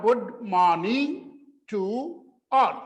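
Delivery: Good morning to all, drawn out word by word; slow model phrase. Shown as a demonstration. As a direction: say "Good morning to all" slowly and clearly for the class. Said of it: "इसका ये हुआ". (धीरे-धीरे साफ़ उच्चारण)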